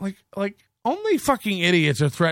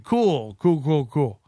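sarcastic; 3 semitones lower, slower than usual. Pretend. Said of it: "dismissive reply".

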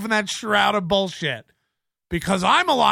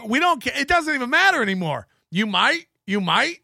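ordinary talk, nothing marked

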